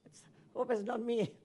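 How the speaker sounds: usual speaking voice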